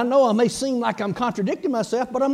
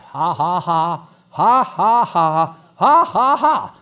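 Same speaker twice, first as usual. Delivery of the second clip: deliberate, loud "ha, ha, ha"; demonstrated laugh; 2 semitones lower, 6 dB louder, slower than usual. Shown as a demonstration. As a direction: laugh defiantly at the devil, on purpose. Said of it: "where you can stand and laugh".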